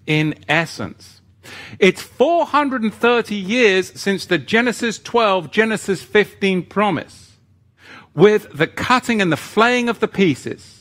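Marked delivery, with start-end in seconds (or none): 1.8-10.7 higher and louder, driving the teaching home; impassioned sermon climax; full-force preaching. Acted. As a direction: preach it with rising fervour.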